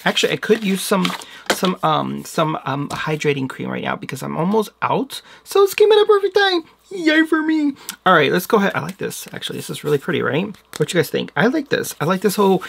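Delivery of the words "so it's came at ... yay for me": excited squeal. Real, not acted. Happy the cream arrived.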